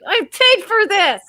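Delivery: high, drawn-out indignant quote; mock outrage. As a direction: play the put-out diner, high and slow. Voices annoyed diner beside fighting couple.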